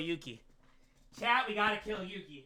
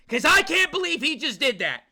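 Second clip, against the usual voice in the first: high, loud burst of disbelief; outraged outburst; 6 semitones higher, 7 dB louder than usual. Pretend. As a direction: shout it in mock outrage. Mock outrage at Yuki.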